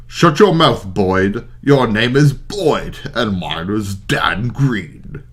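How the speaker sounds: knight snapping back at a rival; angry retort. Acted. Quarrel between characters.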